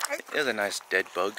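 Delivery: ordinary talk, nothing marked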